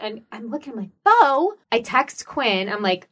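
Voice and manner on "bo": startled high cry of a name; mild retold shock; startled exclamation. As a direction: blurt it out higher, in retold disbelief. Spotting her little boy, startled.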